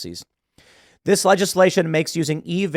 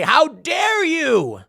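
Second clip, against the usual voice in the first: slow, much higher sneering "how dare you"; mock outrage; 12 semitones higher, much slower than usual. Host mocking protesters against DeSantis, slow high mock outrage. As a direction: drag it out like a scandalised caricature.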